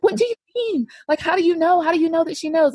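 high-pitched, panicked questioning; freaking out. Retold or imitated. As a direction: reenact panicking on the phone. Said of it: "I was freaking out over the phone".

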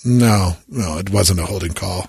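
low mock denial; grumbled objection, sinking in pitch; Jason Kelce. Voicing the losing brother's look to camera, low imitated denial. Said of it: "and he's like".

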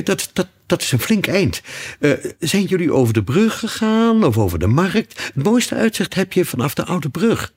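high, stammering nervous king; jittery high-pitched rambling; nervous, trembling voice. A narrator's reading. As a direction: babble nervously, pitch far up. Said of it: "zijn stem bibberde helemaal".

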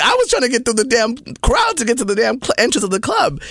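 high-pitched, indignant retelling; acted-out flustered complaint; exasperated protest. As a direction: protest your innocence in a high voice. Retells own protest to the couple.

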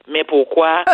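put-on mother's Haitian accent, lightly done. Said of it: "je vais faire l'accent de ma mère".

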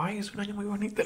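very soft, hushed aside. Retold or imitated. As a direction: say it almost under your breath. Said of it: "con la boca pequeña".

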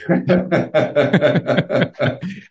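high, rolling belly laugh; hearty laugh. Real, not acted. Officer tops off his Watts story, hearty laugh. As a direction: laugh it out in a long run.

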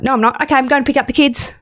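high, quick mock normality; suddenly snapped out. Imagined patient abruptly recovering from feeling dead, mock breezy. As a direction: play a sudden switch to everyday business. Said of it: "all of a sudden you're like".

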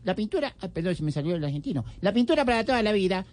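ordinary talk

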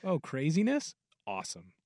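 high, sing-song curious voice that drops off; mock amused onlooker. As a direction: gawk at the chaos, then deadpan "Awesome". Mock bemused onlooker quote.

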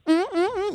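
high-pitched "mm, mm, mm"; imitated game noise. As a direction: mimic a video game charge sound in high hums. Imitates game character's charge cue.

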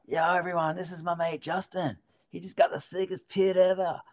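put-on high, loud American accent; hammed-up American surfer accent, hyping. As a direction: introduce a mate in an imitated American accent. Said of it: "he had that Yank accent".

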